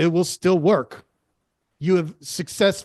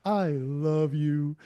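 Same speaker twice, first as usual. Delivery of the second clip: slow drawn-out take; much slower than usual. Shown as a demonstration. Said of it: "They all sound super different".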